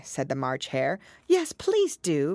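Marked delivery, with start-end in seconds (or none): 1.2-2.4 high, drawn-out entreaty; pleading. A narrator's reading. Alice begging the Dormouse for a story, pleading. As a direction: coax with a long, high please. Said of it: "pleaded Alice".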